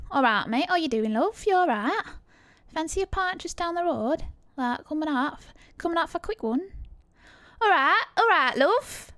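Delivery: chirpy pub-invite Cockney lilt; Cockney accent. Shown as a demonstration. Inviting a mate for a pint, Cockney accent.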